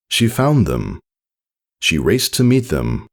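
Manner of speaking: ordinary delivery, nothing marked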